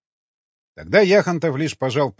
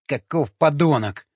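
higher, voiced indignant exclamation; hissed contempt; 4 semitones higher, 4 dB louder than usual. A narrator's reading. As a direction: spit it out in mild outrage. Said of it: "прошипел Вячеслав". (злобно, с презрением)

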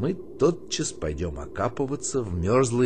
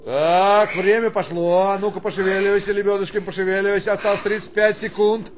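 raised, higher drill-sergeant urging; drill command; 9 semitones higher, 5 dB louder, faster than usual. Acted. Colonel hurrying the private through a drill. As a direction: push him to move, loud and high.

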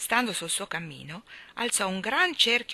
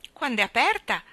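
put-on higher, louder children's reply; chorused answer; 5 semitones higher, 6 dB louder than usual. A narrator's reading. Jane and Michael answer the clown's riddle, chorusing. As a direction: pipe up with the answer. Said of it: "risposero in coro Jane e Michael".

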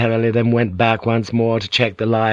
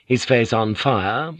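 ordinary delivery

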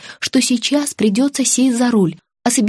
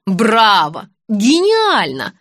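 drawn-out, ironic acclaim; mock applause; much slower than usual. Friend clapping at her idea, sarcastic. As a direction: cheer it sarcastically, stretching the second word.

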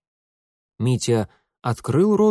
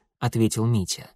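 usual speaking voice

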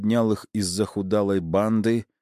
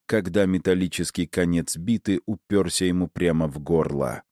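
ordinary delivery, nothing marked

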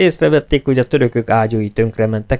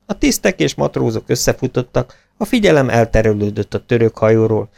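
ordinary delivery, nothing marked

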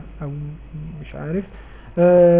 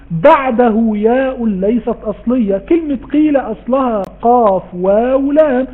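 ordinary talk

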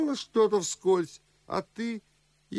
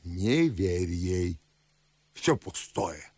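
dismissive advice; 8 semitones lower than usual. Acted. Father telling visitor to ignore the dream, low dismissal. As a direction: wave it away, low and unhurried.

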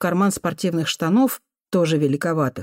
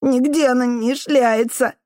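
high, slow tearful protest; crying boy; 6 semitones higher than usual. A narrator's reading. Boy defending his mother to the janitor, crying. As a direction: protest through tears, as a child. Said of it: "плакал пацан".

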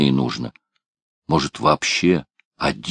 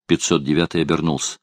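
ordinary delivery, nothing marked

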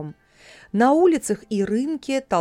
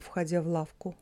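ordinary delivery, nothing marked

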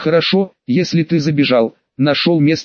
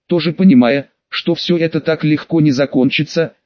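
usual speaking voice

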